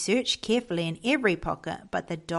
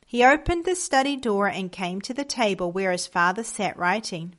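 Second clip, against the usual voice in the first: usual speaking voice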